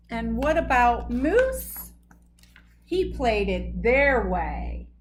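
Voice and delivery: usual speaking voice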